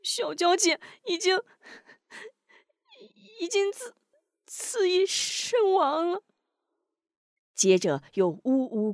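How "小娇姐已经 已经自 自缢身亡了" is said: high, broken, crying voice, slowing at the end; tearful halting confession; sobbing. Acted. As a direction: choke out the terrible news through tears. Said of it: "抽泣道".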